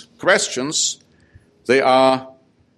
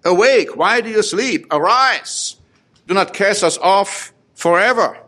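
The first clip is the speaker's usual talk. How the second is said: raised, high call to wake God; urgent cry; 7 semitones higher than usual. Acted. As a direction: cry out to a sleeping Lord.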